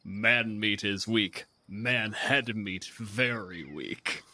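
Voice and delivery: very slow, broken troll speech. Acted. Troll scorning human food, slow and heavy. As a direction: chew each word like a dumb brute.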